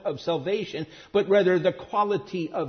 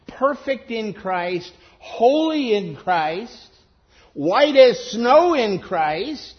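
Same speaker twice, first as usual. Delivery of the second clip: building slower, louder, higher to snow; rising preacher build; 4 semitones higher, 6 dB louder, slower than usual. Real, not acted. Preacher to congregation, each phrase swelling slower and louder.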